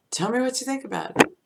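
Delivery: a needy voice fishing for a compliment; eager asking. Pretend. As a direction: ask it eagerly, almost bubbling. Voicing God asking for praise.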